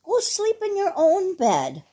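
high, bossy voice; scolding sheep. A narrator's reading. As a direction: shoo the dog off indignantly.

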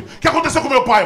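high-pitched mock panic; hammed-up worried demand; alarmed father. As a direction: act out sudden alarm, voice jumping up. Alarmed father demands news, acted.

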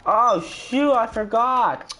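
high, slow dismayed cry; dismayed realization, high and drawn; surprised. Real, not acted. Realizes mistake mid-fall.

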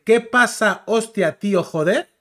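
put-on teasing meme central Spain accent; meme Spaniard. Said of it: "es como un meme".

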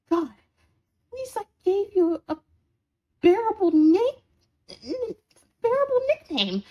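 high-pitched, stammering indignation; exasperated. Acted. Roommate defending the nickname they gave, exasperated. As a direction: protest loudly, voice jumping up.

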